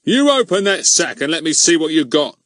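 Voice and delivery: high, loud menacing order; threatening. Acted. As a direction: threaten him like a bullying constable. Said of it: "said Goon threateningly".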